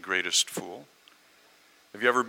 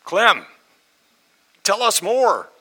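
high, loud acted plea; big pleading yell, pitched way up; crowd calling out; 11 semitones higher, 9 dB louder, slower than usual. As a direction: shout for more from across the room. Crowd begs him to continue.

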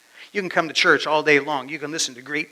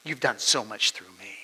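hushed prayer; 5 semitones lower, 7 dB quieter than usual. Retold or imitated. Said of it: "he's like".

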